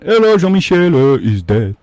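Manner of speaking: put-on French-accented dad on an answering machine; French dad voicemail. Son imitating dad's voicemail opening, French accent. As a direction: leave a message like a French father. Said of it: "It goes".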